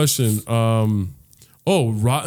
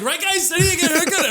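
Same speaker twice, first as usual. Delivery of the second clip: very high, goofy imitation of a friend; whiny mock rallying of the class; mocking call-out. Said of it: "No, you're like".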